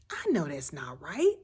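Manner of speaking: breathy unvoiced whisper; whispered inner thought. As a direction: whisper it to yourself like a secret. Voicing inner self-questioning.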